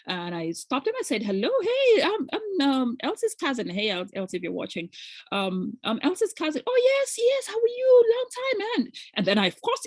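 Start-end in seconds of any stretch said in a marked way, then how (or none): 1.2-6.6 bright, much higher retold hello; eager high greeting. Retelling her eager greeting. 6.7-8.9 much higher put-on voice of the older man; imitating the gentleman. Gentleman answers her greeting.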